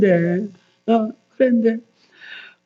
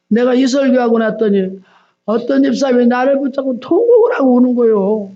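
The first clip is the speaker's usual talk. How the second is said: ordinary talk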